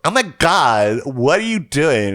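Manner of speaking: slow down on what are you doing; shocked. Retold or imitated. Friend Sage catching the hobo in the pool, shocked. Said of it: "She's like".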